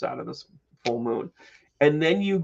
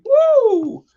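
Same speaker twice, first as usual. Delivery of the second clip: high loud woo; excited whoop; 10 dB louder than usual. Real, not acted. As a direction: let out a whoop.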